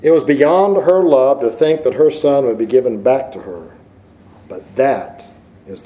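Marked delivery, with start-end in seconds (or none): none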